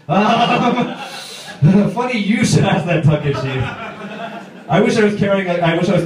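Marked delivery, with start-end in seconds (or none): none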